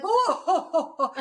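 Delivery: high, laughing whoa as a merry Santa. Retold or imitated. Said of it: "and he's like".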